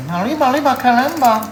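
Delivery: high, loud Speedy Gonzales style cry; hammed-up Spanish-accented arriba; mock Spanish exclamation. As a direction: shout it like a cartoon Mexican mouse.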